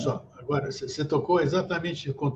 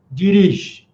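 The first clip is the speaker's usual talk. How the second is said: loud, emphatic order, slightly raised; acted parental command; 3 semitones higher, 10 dB louder than usual. Father letting his child drive the car, acted loud command. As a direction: give the order as an exasperated parent, much louder.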